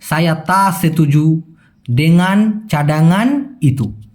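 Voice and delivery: slow, careful Malay demo; teacher's slow Malay model line; slow model sentence. Shown as a demonstration. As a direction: spell the Malay out slowly for learners. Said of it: "দেখেন একই বাক্য".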